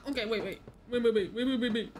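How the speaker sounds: urgent loud repeated wait; panicked. Real, not acted. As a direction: blurt it in a rush. Panicked calling in game.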